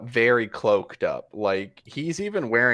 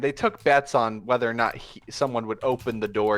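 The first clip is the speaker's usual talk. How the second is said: normal talking voice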